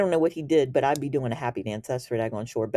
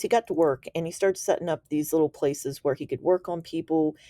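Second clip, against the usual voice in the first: ordinary talk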